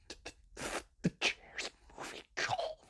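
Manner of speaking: mock-scared whisper to the host; terrified whisper. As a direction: whisper it like a frozen scared kid. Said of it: "the kid would just be like".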